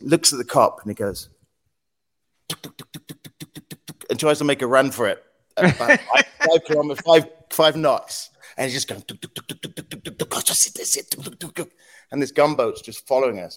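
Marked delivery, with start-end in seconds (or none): 2.5-4.0 very soft voiceless engine putts; imitated putt-putt motor. Fisherman making a run for it, softly imitated motor putts. 9.0-11.7 soft, mostly voiceless engine chugging; imitated putt-putt motor. Imitates the slow getaway boat.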